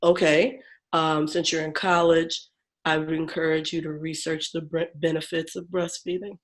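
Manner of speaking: a hint of the calm monotone doctor. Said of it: "He was very monotone".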